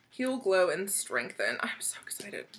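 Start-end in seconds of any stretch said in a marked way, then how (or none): 1.6-2.5 hushed giddy whisper; softly breathed excitement; excited whisper. Real, not acted. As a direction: whisper it to yourself. Quiet giddy aside.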